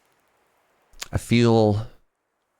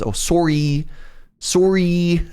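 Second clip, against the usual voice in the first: high, drawn-out Canadian "sorry" with a long O; hammed-up Canadian "sorry", SORE-ee, pitched up; 9 semitones higher, slower than usual. Shown as a demonstration. Streamer showing the very Canadian "sorry", exaggerated Canadian accent. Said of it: "but the very Canadian is".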